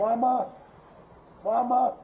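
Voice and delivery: loud desperate calls for mama; desperate calling. Real, not acted. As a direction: call out in real despair. Man pinned down calling for mother.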